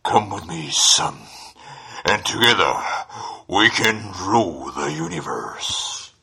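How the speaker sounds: low, breathy masked villain; menacing whispered villain; dark lord whisper. Shown as a demonstration. Darth Vader impression.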